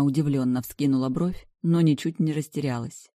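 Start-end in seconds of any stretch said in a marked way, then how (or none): none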